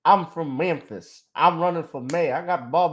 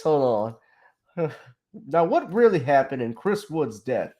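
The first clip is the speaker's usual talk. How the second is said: ordinary talk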